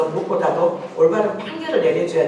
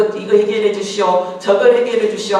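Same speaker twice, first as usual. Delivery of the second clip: raised, insistent petitioners' voices; mock clamouring petitioners; pleading demands; 3 semitones higher, 6 dB louder than usual. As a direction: press the demands loudly, one after another. Acting out petitioners pestering the judge.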